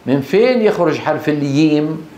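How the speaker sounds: asker's question with الييم for jim. Retold or imitated. Someone asks the teacher about the letter, jim as ya. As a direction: put a y at the start of الييم. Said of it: "مرة سألني واحد".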